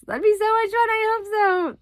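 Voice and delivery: high, loud gushing excitement; excited. Real, not acted. Mom hoping to see the monster trucks, high excited squeal. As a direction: squeal with delight at the thought.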